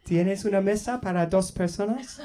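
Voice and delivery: high-pitched, careful tourist Spanish; eager Spanish learner. Retold or imitated. Voicing himself ordering in restaurant.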